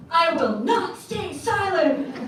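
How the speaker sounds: mock-grand stage defiance; defiant declaration. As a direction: proclaim it like a vow from the stage. Retelling her defiant lecture opening.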